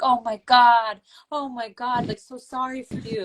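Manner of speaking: a hint of hammed-up family pity; dramatic pity. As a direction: imitate an overwrought relative fussing. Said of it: "the way that this was handled in my household".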